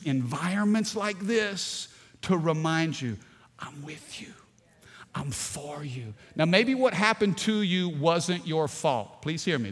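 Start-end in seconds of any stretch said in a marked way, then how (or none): 3.6-6.1 soft whisper of comfort; whispered reassurance. Acted. God reminding the hurting person, whispered comfort. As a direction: whisper it like a gentle promise. God's reassurance voiced softly.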